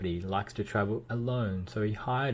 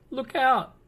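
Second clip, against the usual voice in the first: high loud shout of warning; cried warning; 7 dB louder than usual. A narrator's reading. Mister Busy shouting a warning across the square, loud and high. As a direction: call out the danger. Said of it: "cried Mister Busy".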